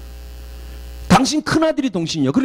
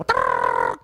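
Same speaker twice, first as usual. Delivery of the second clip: imitated phone ring; 3 dB quieter, slower than usual. Imitates old phone ringing.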